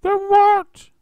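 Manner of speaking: piercing high shriek; terrified wife shrieking; scream. A narrator's reading. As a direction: scream for your life. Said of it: "screamed Missus Twit".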